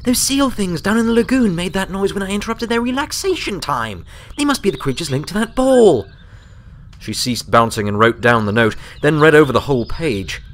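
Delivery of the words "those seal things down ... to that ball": put-on lightly raised, eager young mare; a bright puzzled Twilight, only lightly voiced; reader's gentle Twilight voice, thinking aloud. A narrator's reading. Narrator voices Twilight's lines.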